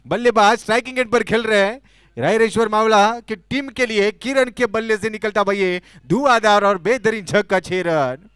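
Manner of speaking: loud, high, stretched six call; excited cricket commentary. Real, not acted. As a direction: roar the back-to-back sixes. Back to back sixes called.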